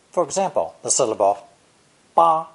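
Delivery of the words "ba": "ba" held high and level, no glide; high flat tone. Shown as a demonstration. Teacher showing learners the first Mandarin tone, high flat "ba". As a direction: say "ba" on a high, flat, loud pitch. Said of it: "spoken with a high flat tone".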